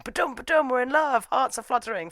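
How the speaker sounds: high fluttery sing-song; mock lovestruck. Symbolizing realization of love at fireworks, mock giddy. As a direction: imitate a pounding heart, giddily romantic. Acting out romantic trope realization.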